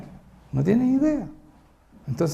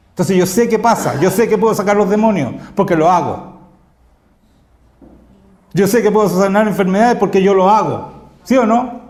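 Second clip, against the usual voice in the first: loud, raised, emphatic preacher's voice; preaching at full force; 4 semitones higher, 8 dB louder than usual. Acted. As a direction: drive each claim home to the congregation.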